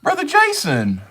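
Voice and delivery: high, loud greeting called out to a viewer; cheery call-out. Real, not acted. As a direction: sing out the name like a glad welcome. Calling out to new viewer.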